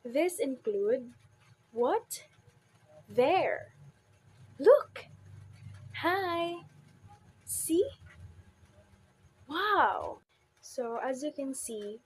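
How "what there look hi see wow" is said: each word alone, punched higher: what, There, Look, Hi, See, Wow; stressed single syllables. Shown as a demonstration. Teacher lists one-syllable examples, each stressed and pitched up. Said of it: "carry stress naturally when spoken in isolation".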